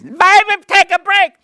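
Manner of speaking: audience yelling out. Retold or imitated. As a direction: belt it out from the seats.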